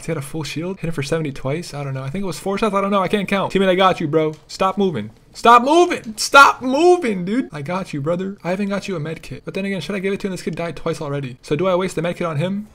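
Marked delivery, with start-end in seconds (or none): 5.3-7.5 loud, high, drawn-out insistence; exasperated shouting. Real, not acted. Player healing a restless teammate, exasperated shouting. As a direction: stretch and shout each word.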